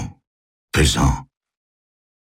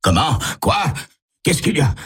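high, bewildered outburst; startled questions; 11 semitones higher than usual. A narrator's reading. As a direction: fire off startled questions, pitch jumping up. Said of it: "lance-t-il en se retournant".

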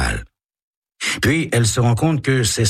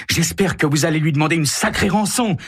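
much higher, eager delight; eager girl; 11 semitones higher than usual. Acted. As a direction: urge it gleefully. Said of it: "continue Carole".

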